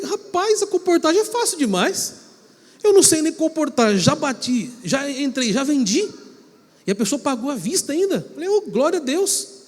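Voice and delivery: high, bright, cocky rush of joy; giddy high-pitched retelling of beginner's luck; overexcited young self. Retold or imitated. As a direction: gush like a kid who just made his first sale. Young self boasting after first sale.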